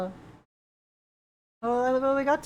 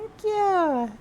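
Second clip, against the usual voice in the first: high bright thanks; excited thanks; 8 semitones higher than usual. Real, not acted. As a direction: gush with gratitude. Thanks for gift subs.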